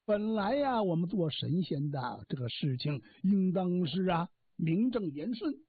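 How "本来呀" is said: ordinary delivery, nothing marked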